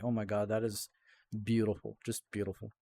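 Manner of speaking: soft, low murmur of wonder, fading; hushed awe. Retold or imitated. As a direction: breathe out quiet admiration. Retelling own awed reaction to music.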